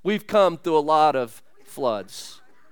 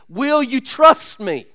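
pleading challenge; 5 semitones higher, 5 dB louder, slower than usual. Acted. Jesus challenging the father.

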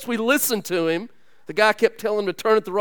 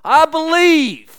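"I believe" proclaimed higher, louder and slow; emphatic confession; 8 semitones higher, 8 dB louder, slower than usual. Shown as a demonstration. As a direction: declare "I believe" like a shout of faith. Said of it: "It's a confession".

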